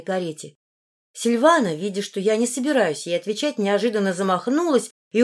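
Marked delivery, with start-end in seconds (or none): none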